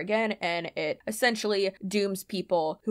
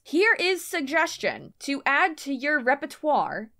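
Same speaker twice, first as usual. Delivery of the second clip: slow, higher, pompous reading of the script; mock reading voice; 5 semitones higher than usual. Mocking the coach's script, slow pompous read. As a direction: read it like a self-important sales script.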